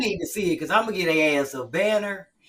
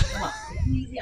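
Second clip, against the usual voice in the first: mock friend; 3 dB quieter, faster than usual. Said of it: "my friends used to be like".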